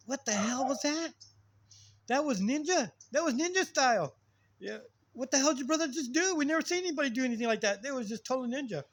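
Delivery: high-pitched, excited retold astonishment; amazed neighbors. Neighbors asking about the brother's fight moves, amazed. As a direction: imitate bystanders marveling, pitched well up.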